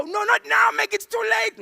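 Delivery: very high, loud frantic cry; hammed-up shrieky panic; panicked warning. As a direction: yell to stop a driver too late. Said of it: "We're panicking at the robots".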